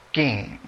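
soft, low "king" in English, short final ng; English word king. Shown as a demonstration. Chess teacher models the English word for students, softly. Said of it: "En inglés".

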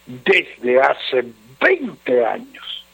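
low, subdued letter-reading voice. Acted. Radio adviser reading a troubled husband's letter, low and measured. Reads listener's letter aloud.

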